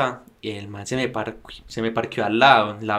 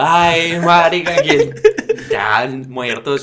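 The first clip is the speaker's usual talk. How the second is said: panicked; 7 dB louder, slower than usual. Retold or imitated. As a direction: act out the terror of the crash, half laughing. Panicked reaction to rear crash. (pánico exagerado, agudo y fuerte)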